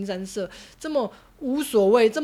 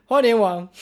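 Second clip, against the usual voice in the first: fake crying; 3 semitones higher, 4 dB louder than usual. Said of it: "我假哭给你看".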